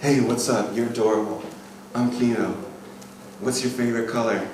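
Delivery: low, easy pickup line; smooth player. Acted. Coach shows confident approach to a girl, smooth player. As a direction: toss it off with assured ease. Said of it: "really easy for me to go to a girl".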